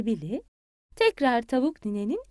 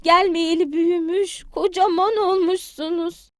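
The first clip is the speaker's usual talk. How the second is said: higher doting voice; fond granny hen; 6 semitones higher, 3 dB louder than usual. Acted. Granny hen dotes on the grown-up chicks, higher and fond. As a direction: coo over the little ones.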